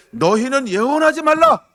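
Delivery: high, loud indignant order; mock-furious leaders' shout; angry command. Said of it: "그들이 말하기를".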